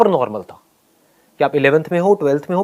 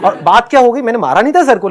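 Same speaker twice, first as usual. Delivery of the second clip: ordinary talk, nothing marked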